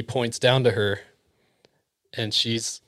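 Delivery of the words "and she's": usual speaking voice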